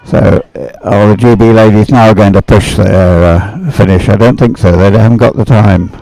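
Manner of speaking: ordinary delivery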